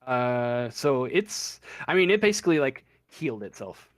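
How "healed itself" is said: normal talking voice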